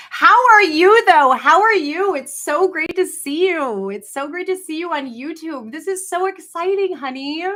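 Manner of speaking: high, loud gushing hello; gushing greeting. Acted. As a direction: squeal like an overjoyed hostess.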